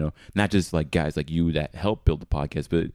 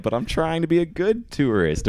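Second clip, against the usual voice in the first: mock-earnest, pitched up high; earnest tourist; 11 semitones higher than usual. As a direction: play the eager good tourist, pitch raised. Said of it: "I'm just sort of like".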